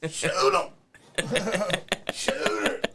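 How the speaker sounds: very high, loud squealing yell; Weasel voice. Pretend. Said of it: "he said you had to do the Weasel voice".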